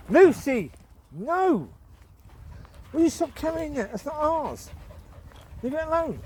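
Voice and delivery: high, loud scold; exasperated shout at the dog. Real, not acted. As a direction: stop the dog carrying it. Scolding dog carrying object.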